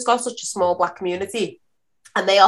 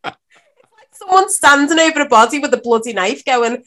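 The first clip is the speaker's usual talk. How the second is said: ordinary talk, nothing marked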